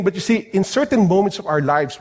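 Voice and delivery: ordinary talk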